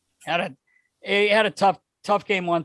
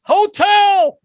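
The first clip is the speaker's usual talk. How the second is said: loud, high holler in passing; high mock yell, one word; yelling back; 10 dB louder than usual. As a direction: imitate a runner shouting over his shoulder. Runner yells back while sprinting by.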